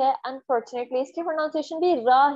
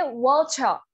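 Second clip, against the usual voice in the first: British "वाचा", ch-like T and no final R; water as British "wau-cha"; British English; slower than usual. Shown as a demonstration. Teacher shows British sound, ch-like T in "वाचा". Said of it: "British English बोल रही हूँ".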